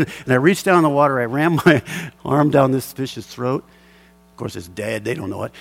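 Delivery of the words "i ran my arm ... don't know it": ordinary talk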